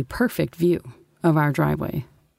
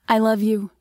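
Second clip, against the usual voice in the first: higher tender mother voice; 5 semitones higher than usual. A narrator's reading. Mom's farewell to daughter.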